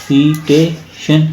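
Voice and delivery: "सीटेशन" slowly as सी, टे, शन; slow teacher's split of "सीटेशन"; syllable by syllable. Shown as a demonstration. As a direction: break "सीटेशन" into three spaced parts. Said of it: "syllable के साथ पढ़ के सुनाओ".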